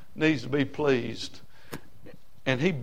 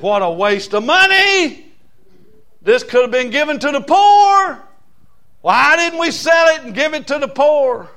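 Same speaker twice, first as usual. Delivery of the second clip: louder, higher outraged protest; indignant Judas; 10 semitones higher, 8 dB louder than usual. Retold or imitated. Preacher voicing Judas objecting to the money, loud indignant protest. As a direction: play Judas scoffing at the waste.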